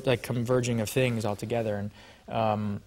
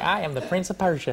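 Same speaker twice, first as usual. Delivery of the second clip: mock Southern accent, pitched up; 6 semitones higher than usual. Actor imitates his early failed British accent, mock Southern. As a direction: play the prince with a Southern twang. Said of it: "a Southern person than I did".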